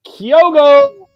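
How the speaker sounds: high, loud name call; cheering shout. Real, not acted. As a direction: yell the name in celebration. Cheering the goalscorer.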